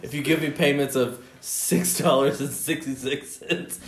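ordinary talk, nothing marked

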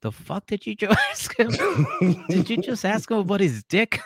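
incredulous inner voice cracking up; laughing disbelief. Retold or imitated. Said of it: "he just went".

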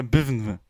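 "bivn" squeezed out with barely any vowel; vowelless word. Shown as a demonstration. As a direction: mumble "bivn" as if the vowels were missing. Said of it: "die Vokale nicht hinzufügen kannst". („bivn“ fast ohne Vokale gesprochen)

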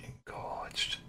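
breathy mock-seductive whisper. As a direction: whisper it in her ear. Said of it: "whisper in her ear".

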